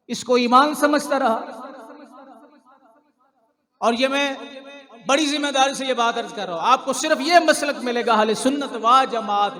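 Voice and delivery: loud, high, insistent sermon voice; forceful preaching. Real, not acted. Preacher warns congregation about the true creed, forceful preaching. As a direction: drive the point home to the congregation.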